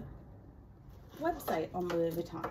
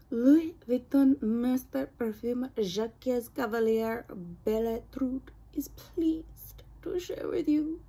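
put-on French accent; 3 semitones higher than usual. Vlogger reading the perfume card to viewers, put-on French accent. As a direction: read it grandly in a mock French accent. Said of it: "I guess that's my French accent".

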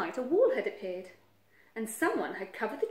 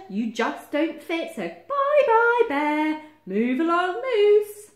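very loud, high send-off; bossy Badger shooing them away; gleeful dismissal; 8 semitones higher, 11 dB louder, slower than usual. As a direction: call out farewells with drawn-out words.

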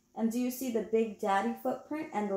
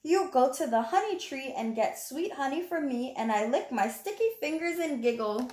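put-on reading to children; 4 semitones higher than usual. Reading the picture book aloud.

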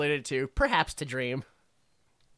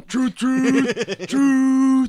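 loud, high hoots on "truth"; long whistle-blast "truth"; train whistle; 5 semitones higher, 6 dB louder, much slower than usual. Pretend. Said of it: "the truth emotion".

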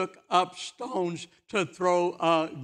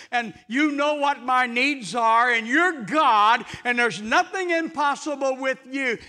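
raised, fervent spoken prayer, slower at the end; demonstration prayer; 7 semitones higher, 9 dB louder than usual. Shown as a demonstration. Preacher modelling a prayer for the congregation, raised and fervent. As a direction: pray it out loud as an example. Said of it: "So why don't you simply say it?".

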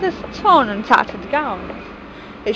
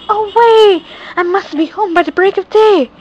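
higher, loud urgent call; urgent cry; 7 semitones higher, 5 dB louder than usual. A narrator's reading. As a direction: hurry the coach on. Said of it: "reached the coach and cried".

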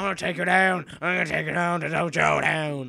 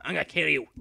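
ordinary delivery

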